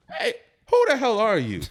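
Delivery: loud, very high comic yell; mock outraged demand. Viewer suddenly challenging a suspicious character, mock outraged. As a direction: shout it in hammed-up disbelief.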